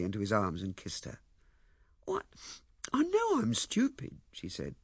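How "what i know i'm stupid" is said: high, slow, plaintive admission; tearful. Acted. Ethel runs herself down to Lewisham, tearfully. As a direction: admit it slowly in a small high voice.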